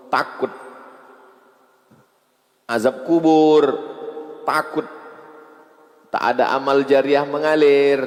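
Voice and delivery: high, loud, building list of fears; fiery preaching. Acted. Preacher to the congregation on fear of the grave, fiery and loud.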